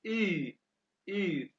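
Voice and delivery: "ई" as long German ü with rounded lips; drawn-out "ई", lips pursed into ü; umlaut pronunciation demo. Shown as a demonstration. Teacher shows how U-umlaut sounds, long vowel demo. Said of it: "इनको pronounce कहते हैं, कैसे करते हैं".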